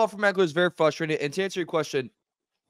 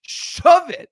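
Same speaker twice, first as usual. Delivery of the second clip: "Shove it" shouted even louder and higher, with "Shove" hit hard; louder angry repeat; 7 semitones higher, 6 dB louder than usual. Real, not acted. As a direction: really let him have it on the repeat.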